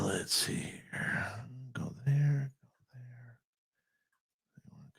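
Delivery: whispered under his breath; barely audible whisper to himself. Real, not acted. Muttering to himself at the mic.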